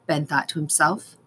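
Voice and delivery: usual speaking voice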